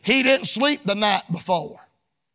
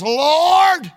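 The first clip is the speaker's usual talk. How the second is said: calling out; 4 semitones higher, 4 dB louder than usual. Retold or imitated. Thief calls to Christ.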